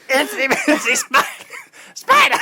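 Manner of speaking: high, squeaky comic voice, a little out of reach; Gilbert Gottfried impression. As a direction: strain for the high comedian voice. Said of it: "I don't know why I have an accent".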